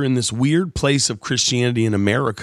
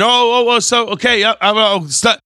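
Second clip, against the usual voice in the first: flustered TV host; 10 semitones higher, 5 dB louder than usual. Retold or imitated. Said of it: "they always run out of time".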